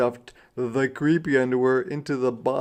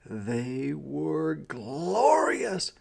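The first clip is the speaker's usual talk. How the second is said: ordinary delivery, nothing marked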